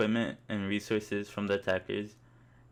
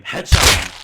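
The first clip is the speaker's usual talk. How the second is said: much louder blasted yell; scream; 2 semitones lower, 16 dB louder than usual. Acted. Gamer screams his headshot callout again, blasting loud. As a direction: blow out the mic yelling it. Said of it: "you then scream".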